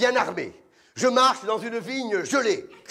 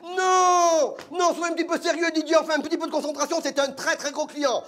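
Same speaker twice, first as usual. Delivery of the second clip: much higher, pleading and fast; exasperated director; 10 semitones higher than usual. Retold or imitated. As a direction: scold him in a high rush. Directeur s'énerve contre Didier. (directeur excédé, voix très aiguë)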